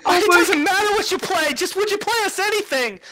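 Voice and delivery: very high pleading gush; high eager coordinator voice; gushing organizer. Acted. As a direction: beg sweetly for any song.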